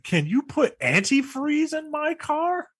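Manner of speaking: slow, high, over-careful question; mock unnatural request. Co-host mocking the unbelievable script line, slow high stilted delivery. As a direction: spell out the odd request in mock innocence. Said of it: "Something a little more believable than".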